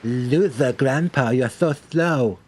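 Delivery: hammed-up taunting drawn-out jeer; exaggerated teasing, slowed at the end; mocking rival. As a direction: imitate a gloating friend with a mock drawl. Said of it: "then he'll be like".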